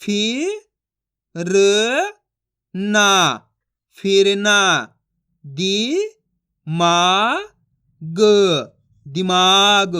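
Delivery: drawn-out phonics drill; direct syllable sounding. Shown as a demonstration. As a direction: sound out each syllable, then join them. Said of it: "अब अपन direct मात्रा का उच्चारण करके पढ़ेंगे".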